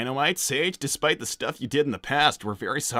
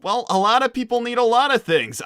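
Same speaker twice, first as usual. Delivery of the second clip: higher and louder, fed up; exasperated outburst; 9 semitones higher, 6 dB louder than usual. Acted. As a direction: throw it back at her, exasperated. Sonic brushes off a plea.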